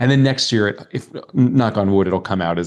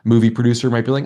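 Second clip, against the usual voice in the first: ordinary delivery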